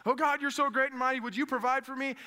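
high, loud hammed-up praise; mock fervent prayer. Believer praying before grabbing the credit card, mock fervent. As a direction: overdo the pleading to God.

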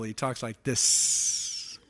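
low, slow, ending in a long hiss; devil's serpent voice. Acted. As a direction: draw out the final S like a snake. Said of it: "You can always tell it's the devil".

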